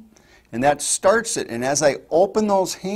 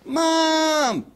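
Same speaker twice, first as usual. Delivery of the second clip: squeaky high fawn-like Mom; high plaintive call for Mom; high bleating child call. Shown as a demonstration. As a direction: call out like a lost little child. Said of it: "Just think of a child".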